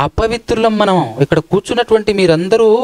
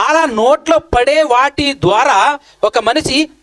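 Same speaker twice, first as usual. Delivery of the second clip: slow emphatic preaching; 5 semitones higher, much slower than usual. Acted. Preacher spells out scripture point.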